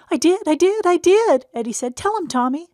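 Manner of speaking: put-on very high, loud insistent kid voice; pleading little boy. Eddie begging Tom to back him up, high insistent child voice. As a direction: beg like a small boy caught out.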